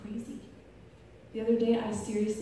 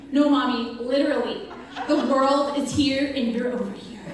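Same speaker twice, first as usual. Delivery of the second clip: loud, higher, emphatic correction; imitated insistent daughter; 5 semitones higher, 11 dB louder than usual. Daughter correcting mom's misunderstanding, imitated loud insistence. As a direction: set Mommy straight, child-like and emphatic.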